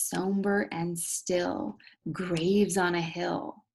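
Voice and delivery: drawn out and slow; slow, hushed reading. Shown as a demonstration. Teacher slowly reads the graveyard line, hushed. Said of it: "right here, it says".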